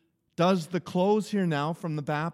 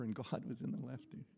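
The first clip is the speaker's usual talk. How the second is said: normal talking voice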